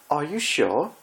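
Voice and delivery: high, slow, theatrical worry; posh pony fussing over a friend's ordeal; dramatic fretting Rarity. Acted. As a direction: overplay the concern like a stage diva.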